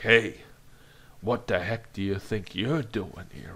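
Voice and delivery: jab the finger and demand an answer; mock angry challenge. Shown as a demonstration. Character pointing at an intruder, in mild mock anger. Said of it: "the classic, you know, point".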